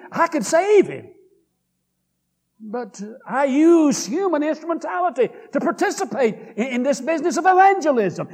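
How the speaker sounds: high, loud preaching cry for the voice of God; preacher voicing God, calling out over the congregation; impassioned preaching shout. Preacher retelling God's words, in a high loud preaching cry.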